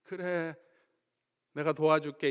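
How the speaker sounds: mock-gentle comforting God. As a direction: ease his pain tenderly. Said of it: "이렇게 어프로치 하지 않으시고".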